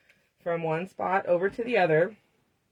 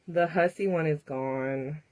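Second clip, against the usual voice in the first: ordinary talk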